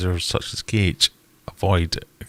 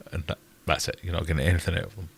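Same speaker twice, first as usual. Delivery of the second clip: ordinary talk, nothing marked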